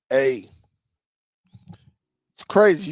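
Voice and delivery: normal talking voice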